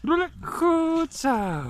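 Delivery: high squeaky coaxing call; coaxing the dog. Real, not acted. Owner coaxing his dog to roll over, high squeaky calls. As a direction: squeak it up to get the dog rolling.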